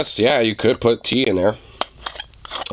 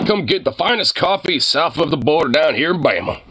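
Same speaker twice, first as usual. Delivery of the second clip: high-pitched mock southern sales pitch; southern huckster; 6 semitones higher than usual. Streamer advertising an imaginary coffee business, southern huckster. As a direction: hawk it like a hammed-up southern vendor.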